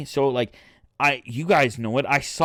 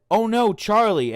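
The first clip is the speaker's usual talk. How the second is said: mock alarm; 8 semitones higher, 4 dB louder than usual. Acted fake alarm before shooting Charlie.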